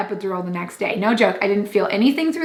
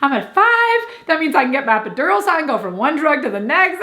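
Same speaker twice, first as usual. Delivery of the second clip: high-pitched, giddy retold delight; in hog heaven; 7 semitones higher than usual. Labouring mother delighted she feels nothing before her epidural, retold giddy glee. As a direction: gush it high, hammed-up and thrilled. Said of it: "in hog heaven".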